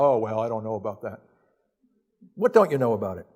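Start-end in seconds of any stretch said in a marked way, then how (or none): 0.0-1.2 mock dismissive. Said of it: "critics quoted".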